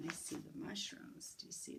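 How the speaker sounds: soft unvoiced whisper. Teacher whispering to children about mushrooms. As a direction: breathe it like a secret.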